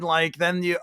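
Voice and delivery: ordinary delivery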